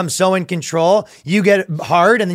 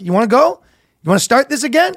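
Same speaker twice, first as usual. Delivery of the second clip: pitched-up taunt, rising at the end; mock fight challenge; 8 semitones higher, faster than usual. Acting a man threatening his own body, mock aggressive. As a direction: pick a pretend fight, voice climbing.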